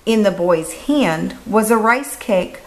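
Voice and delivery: plain reading voice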